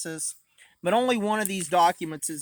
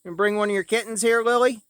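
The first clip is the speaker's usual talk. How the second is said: calling out; 5 semitones higher, 7 dB louder, faster than usual. Real, not acted. Calling daughter to bring kitten.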